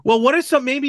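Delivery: normal talking voice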